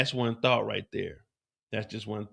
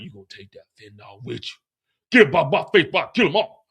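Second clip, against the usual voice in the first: mock threat; 5 dB louder than usual.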